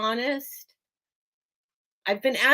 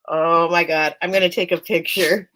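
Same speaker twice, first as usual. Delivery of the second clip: loud amazement; 5 semitones lower, 5 dB louder than usual. Real, not acted.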